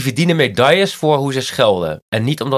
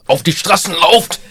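put-on German accent; 6 semitones higher, 4 dB louder than usual. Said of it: "alles in Duits klinkt toch als een scheldwoord".

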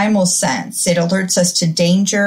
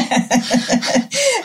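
high-pitched embarrassed laugh; bright laugh; 6 semitones higher than usual. Real, not acted. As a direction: laugh it off lightly. Guest laughing at herself.

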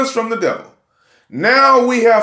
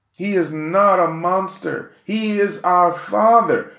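normal talking voice

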